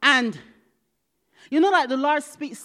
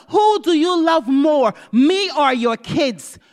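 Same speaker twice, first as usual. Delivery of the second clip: slow shouted question, pitch lifted; louder, imitating Holy Spirit; 4 semitones higher, 9 dB louder, slower than usual. Holy Spirit presses the mother who didn't answer, insistent shout. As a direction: thunder it out word by word. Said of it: "And it was louder".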